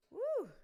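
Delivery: a high, loud joyful woo; big celebratory woo; excited whoop. Real, not acted. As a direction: whoop with delight. Joyful cheer after the affirmation.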